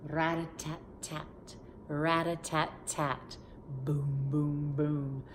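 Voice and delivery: slow rat-a-tat drum sounds; playful band noises; drum imitation. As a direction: beat out the marching drums. Imitating marching band drums.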